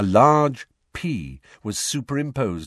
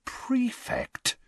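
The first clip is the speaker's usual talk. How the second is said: high, hushed disbelief; incredulous; 6 dB quieter than usual. A narrator's reading. Brother reading the letter over Ron's shoulder, incredulous. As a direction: softly squeak it in disbelief. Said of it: "staring incredulously at the letter".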